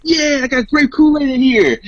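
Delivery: very high, drawn-out mock delight; delighted retold discovery. Retelling finding Kool-Aid in the fridge, high and delighted. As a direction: act out a thrilled find, slow and high.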